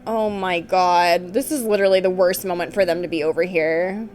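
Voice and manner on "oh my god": ordinary talk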